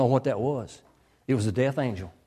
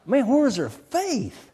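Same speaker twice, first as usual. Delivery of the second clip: high, drawn-out disbelief; baffled preacher's exasperated question; incredulous; 8 semitones higher, slower than usual. Retold or imitated. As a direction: ask it in slow, high-pitched amazement. Preacher astonished at their disbelief.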